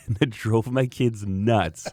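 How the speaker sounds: ordinary talk, nothing marked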